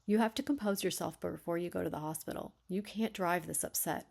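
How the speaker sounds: ordinary delivery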